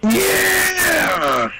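high shout of joy; excited cheer. Real, not acted. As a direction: whoop it out. Celebrating a play.

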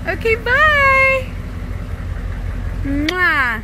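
very high, loud, slow singsong; high singsong goodbye. Real, not acted. Mom waving goodbye to her daughter on the bus, high cooing.

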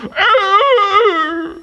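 high, loud howl like a hurt dog; dog's pained howl. Retold or imitated. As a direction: let out a dog's cry of pain. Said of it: "the dog go".

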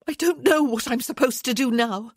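high, loud, tearful outburst; voice breaking. A narrator's reading. Woman spilling her despair to him, voice breaking. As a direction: let it spill out, close to breaking. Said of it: "hearing her voice break".

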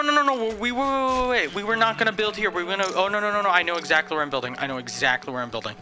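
usual speaking voice

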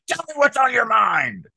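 loud, very high parade-ground shout; drill instructor. Acted. Former Marine mocking the aggressive reading, drill instructor yell. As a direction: bellow it like a mock drill instructor. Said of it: "no drill instructor came up to me and went".

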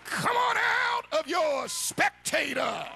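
ordinary talk

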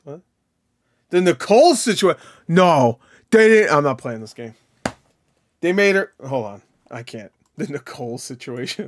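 ordinary talk, nothing marked

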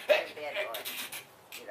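slow breathy broken hey, hey, hey; stuttering leader. Acted. Preacher imagining Moses stammering before the world. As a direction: drag out each hey as if stuck. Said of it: "Moses stuttered".

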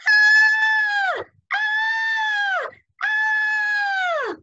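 shrill, loud squealing scream; high piggy scream. Acted. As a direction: scream high like a frightened pig. Pigs scream as house falls.

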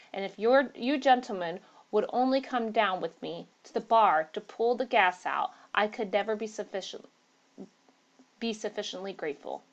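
plain reading voice

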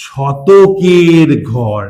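শতকের ঘর stretched very slow; শতকের ঘর said very slowly with the stress on শতকের; drawn-out key word. Shown as a demonstration. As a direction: draw out শতকের so it sticks. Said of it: "আমরা বলি".

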